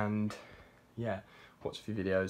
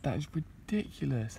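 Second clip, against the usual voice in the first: ordinary talk, nothing marked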